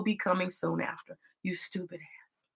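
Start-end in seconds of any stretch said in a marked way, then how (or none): 1.4-2.4 breathy whispered put-down; whispered insult. Real, not acted. Vlogger mutters insult at Ilhan, whispered. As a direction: hiss it under your breath.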